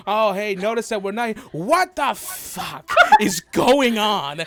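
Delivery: drawn-out, loud bewildered exclamation; Baron skull. Acted. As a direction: start casual, then stretch out the disbelief. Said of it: "Baron skull out and goes".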